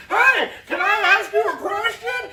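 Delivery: high, far-off mimic of the asker; sarcastic high-pitched imitation; mocking needy asker. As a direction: sneer it up high like the annoying customer. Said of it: "if you go".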